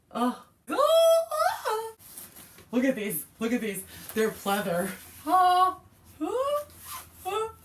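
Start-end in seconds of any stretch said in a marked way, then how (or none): 0.7-1.9 high delighted squeal; excited squeal. Real, not acted. Unboxing a birthday present, excited squeal. 5.2-6.6 delighted squeals. Real, not acted. Excited unboxing squeals.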